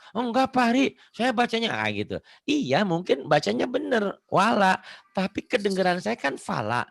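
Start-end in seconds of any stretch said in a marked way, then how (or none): 0.1-1.7 higher-pitched protesting pupil; arguing pupil. Retold or imitated. Teacher imitating a pupil debating with him, higher protest. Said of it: "kalau sama Yani kan kadang-kadang sering".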